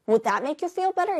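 a snide sarcastic retort; aggressive sarcastic. Shown as a demonstration. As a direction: throw it back at them mockingly. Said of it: "상당히 공격적인 투로 바뀌었어요".

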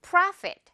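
bright clear Profit to copy; high model Profit. Shown as a demonstration. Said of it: "One more time".

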